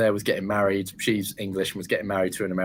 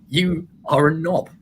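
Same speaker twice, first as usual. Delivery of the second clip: imagined telling-off; 7 semitones higher, 5 dB louder, slower than usual. Retold or imitated. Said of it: "and I just think".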